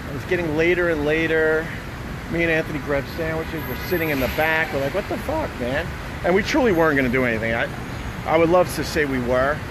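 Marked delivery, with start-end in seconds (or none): none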